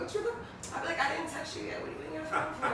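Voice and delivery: sassy laughing tease; teasing call-out. Pretend. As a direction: tease him for being on his phone.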